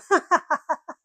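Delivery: high staccato cackle, imitating a squirrel; teasing ha-ha-ha of a squirrel; mocking squirrel laugh. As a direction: laugh down at someone from a tree. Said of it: "squirrel's up that tree just laughing".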